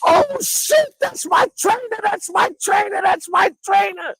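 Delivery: very high, screechy shouting; ear-splitting excited shout. Retold or imitated. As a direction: reenact a fan losing it at the TV. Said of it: "killed everybody's eardrums".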